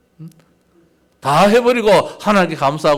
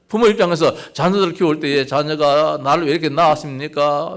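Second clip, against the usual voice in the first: normal talking voice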